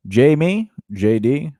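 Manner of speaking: normal talking voice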